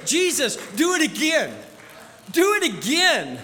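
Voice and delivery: loud, delighted call; joyful exclaim. Acted. Believer praising Jesus for what he has done, delighted. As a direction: cheer God on with joy.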